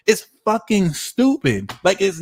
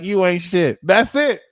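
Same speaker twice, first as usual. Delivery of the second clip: higher, louder "You ain't shit"; sassy retort; 4 semitones higher, 7 dB louder than usual. Shown as a demonstration. Vlogger showing viewers how to answer insults, sassy. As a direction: cut them down flat. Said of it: "be like".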